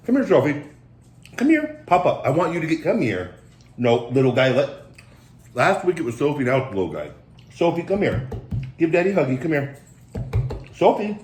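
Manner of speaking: a high coaxing call to a reluctant dog. Real, not acted. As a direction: coax the dog over in a lifted voice. Calling the dog over.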